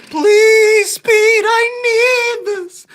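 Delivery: screamed high, slow begging; hammed-up wailing plea; desperate mock pleading. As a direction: beg in an over-the-top squeal.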